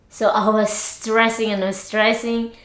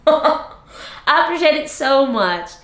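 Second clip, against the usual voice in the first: higher, livelier gratitude after a laugh; laugh, then say it out brightly and higher; animated thanks; 5 semitones higher than usual. Real, not acted. Repeats thanks louder after laughing.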